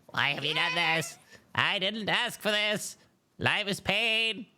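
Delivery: squeaky high, slow wail; tiny voice. Pretend. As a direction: lament like a tiny creature. Mock despair after shrinking.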